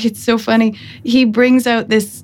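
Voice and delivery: normal talking voice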